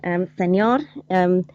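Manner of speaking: mock Spanish accent on the phone. Marie phoning reception about free bread, mock Spanish accent. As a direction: play a flustered Spanish receptionist caller. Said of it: "imagine Marie phoning up to reception".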